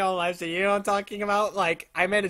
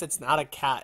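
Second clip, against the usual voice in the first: ordinary talk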